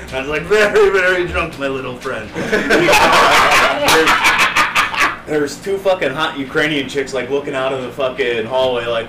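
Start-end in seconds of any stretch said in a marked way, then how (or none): 0.4-2.3 put-on high, loud drunken answer; loud drunk reply. Drunk admission retold. 2.3-5.2 high, loud burst of laughter. Real, not acted. Big laugh.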